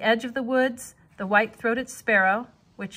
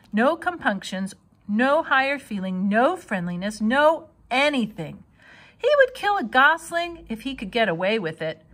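ordinary delivery, nothing marked

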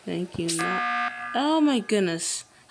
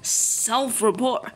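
ordinary talk, nothing marked

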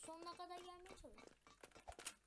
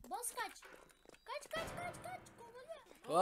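urgent shouting; 7 semitones higher, 7 dB louder, slower than usual. Real, not acted. Urging friend to flee chaser.

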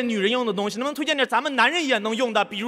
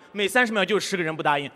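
ordinary talk

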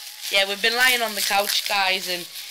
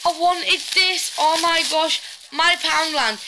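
excited; 6 semitones higher, 3 dB louder than usual. Real, not acted. Excited unboxing.

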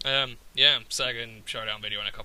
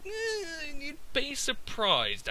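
high, slow knowing drawl; sarcastic drawl; much slower than usual. Real, not acted. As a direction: drag it out, unconvinced. Sceptical drawn-out aside.

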